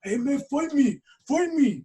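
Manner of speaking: high, loud mock drunk goading; drunk challenger. Drunk guy following them, high loud hammed-up challenge. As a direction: imitate a drunk begging for a fight. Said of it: "He's like".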